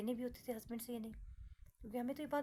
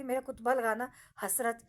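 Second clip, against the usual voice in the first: loud insistence; 12 dB louder than usual. Retold or imitated. Dictating her epitaph to husband.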